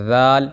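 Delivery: ذال said gently, with soft pronunciation; soft dhal. Shown as a demonstration. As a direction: pronounce ذال softly, no force. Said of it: "اس کو نرمی کے ساتھ پڑھنا ہے". (ذال نرمی سے)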